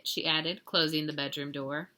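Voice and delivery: usual speaking voice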